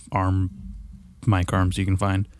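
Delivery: usual speaking voice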